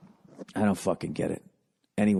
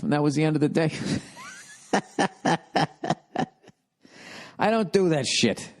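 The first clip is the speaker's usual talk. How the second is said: ordinary talk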